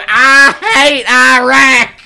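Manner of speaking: high, drawn-out full shout; loud in the bar. Retold or imitated. Caller saying it loud and proud in the bar, yelling. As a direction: bellow it for the whole room. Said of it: "just loud in the bar".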